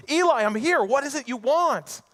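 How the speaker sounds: put-on high, loud eager little boy calling out; a small boy waking his mentor at night, high and eager; child Samuel. Young Samuel calls to Eli at night, high and eager.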